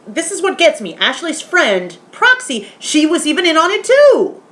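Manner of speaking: high, incredulous outburst; worked-up, high-pitched indignation; exasperated. Real, not acted. Outraged at friend's betrayal.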